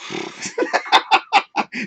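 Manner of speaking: high wheezy laugh; real laugh at himself; breathless laugh. Real, not acted.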